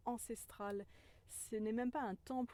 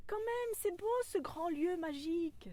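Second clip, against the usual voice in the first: much higher, louder longing voice; little inner voice; 10 semitones higher, 6 dB louder than usual. Pretend. Inner voice still longing for the stone, high and childlike. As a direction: sigh over the beauty like a child. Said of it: "cette petite voix intérieure ... qui me disait".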